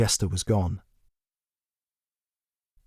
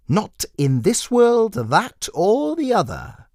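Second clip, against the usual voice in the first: high, slow, sing-song jester voice with a cold edge; a creepy clown savouring each word; menacing jester; 11 semitones higher than usual. Acted.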